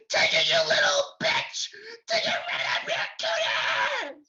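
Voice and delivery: loud, shrill shouting mom impression; mock shouted mom. Kid imitating mom shouting from the bedroom. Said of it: "mom saying things like".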